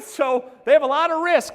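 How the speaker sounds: high, loud, driving the point home; emphatic shout. Real, not acted. Coach shouting the key point to his agents, loud. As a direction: shout it at the room so it sticks.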